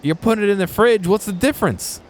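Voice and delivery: high, loud incredulous yell; high loud what-the-fuck outburst; retold outburst. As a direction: yell it in disbelief at the stranger. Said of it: "And I was like".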